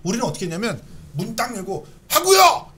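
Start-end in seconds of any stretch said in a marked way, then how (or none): 2.1-2.7 high, loud rallying call; rally the classroom. Retold or imitated. Recalls calling students out. (높고 크게 외치는 목소리)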